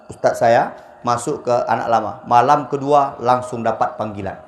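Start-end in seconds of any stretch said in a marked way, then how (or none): none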